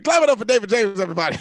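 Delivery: calling for applause. Real, not acted. Host asking applause for the resident evaluator, loud announcement.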